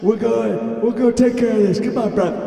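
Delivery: high, loud wrestler hype; rally them in a high excited voice; high excited promo. Acted.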